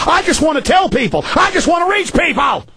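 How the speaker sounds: rising desperate plea. Real, not acted. Host pleading with listeners to spread the warning, rising into a shout.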